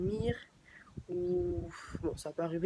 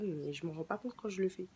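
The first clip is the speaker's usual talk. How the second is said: normal talking voice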